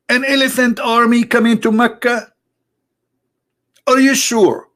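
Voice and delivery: high-pitched incredulous questioning; mock disbelief. Comedian doubting the elephant army story, mock disbelief.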